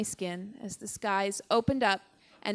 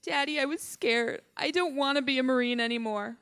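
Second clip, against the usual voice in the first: higher, childlike plea through imitated tears; retold tearful confession; 5 semitones higher than usual. Daughter runs to her rescued father, tearful frightened confession. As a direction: voice pitched up, acting out a scared cry to Dad.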